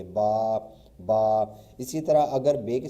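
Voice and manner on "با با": با drawn out as a long "baa"; lengthened sound. Shown as a demonstration. Teacher to students, stretching the long با. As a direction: stretch the vowel in با. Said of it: "تھوڑا سا اس کو نا لمبا کریں گے".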